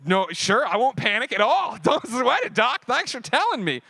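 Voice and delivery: a laughing, sarcastic reply to the doctor who just warned him. Retold or imitated. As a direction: drip with sarcasm at the doc.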